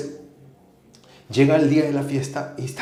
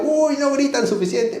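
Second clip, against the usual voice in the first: egging on; 12 semitones higher than usual. Retold or imitated. Egging kids on.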